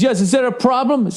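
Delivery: a lightly hammed-up haughty stranger; mock snooty driver. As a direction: play the smug rich guy asking innocently. Retold road quarrel, other driver.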